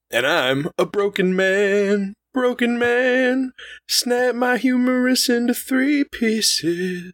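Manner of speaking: high, slow, hammed-up lament; melodramatic broken man. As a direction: wail it like a tragic hero. Jokey self-pitying intro.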